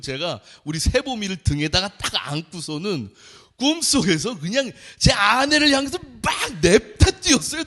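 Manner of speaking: usual speaking voice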